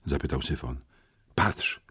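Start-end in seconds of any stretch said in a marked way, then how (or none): 1.3-1.8 ciche, bezdźwięczne słowo; szept. Acted. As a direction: szepnij ostrzegawczo. Chłopiec szepcze w pracowni.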